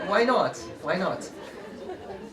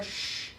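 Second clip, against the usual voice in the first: long shushing hiss; 10 dB quieter than usual. Shown as a demonstration. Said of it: "breathe in as much air".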